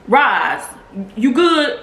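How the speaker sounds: high and loud, offhand; casual man voice. Retold or imitated. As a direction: call out casually. Imitates the donor checking on mom.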